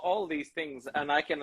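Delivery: usual speaking voice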